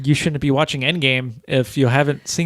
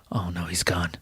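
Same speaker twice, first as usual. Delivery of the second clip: shocked whisper; 8 semitones lower, 9 dB quieter than usual. Retold or imitated. Reliving shock watching the opening.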